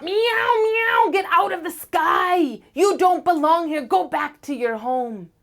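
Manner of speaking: very high, loud furious meowing; screeching cat. Acted. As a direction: screech at the star. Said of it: "screeching up".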